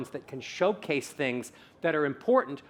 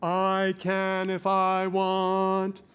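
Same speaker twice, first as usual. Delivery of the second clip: very slow, full sustained tone; intoned, stretched-out drill; bassy voice exercise; 3 semitones higher, much slower than usual. Shown as a demonstration. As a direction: hold each vowel with a bassy resonance. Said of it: "More bassy".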